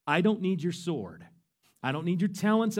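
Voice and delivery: ordinary talk, nothing marked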